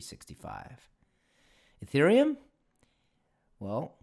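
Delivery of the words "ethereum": Ethereum? thrown up sharply high and loud; sharp rising challenge on the name; pointed rhetorical question. Real, not acted. Rhetorical question set against Visa.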